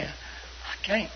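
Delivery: soft, barely voiced refusal; reluctant refusal. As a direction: refuse almost under your breath. Retold reluctant refusal to brother.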